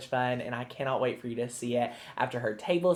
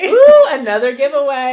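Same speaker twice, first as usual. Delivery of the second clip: a much higher, much louder delighted ooh; excited squeal; 9 semitones higher, 16 dB louder than usual. Real, not acted. Co-host announces a giveaway, she squeals with excitement.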